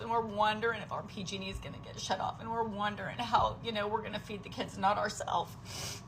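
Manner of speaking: ordinary talk, nothing marked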